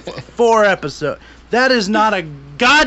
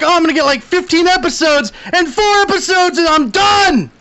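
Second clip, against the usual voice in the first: mock excited fan; 8 semitones higher than usual. Retelling his excitement about clicking the show, high and shouting. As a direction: ham up the hopeful fan. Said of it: "I'm like".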